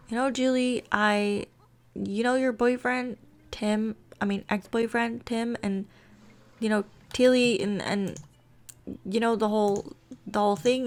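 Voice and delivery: halting confession with false starts; stumbling, unsure. Acted. Rosie trying to confess to Julie, stumbling and unsure. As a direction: stumble over the words, pausing uncertainly. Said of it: "she started talking like that, like, stumbling over her words, being unsure".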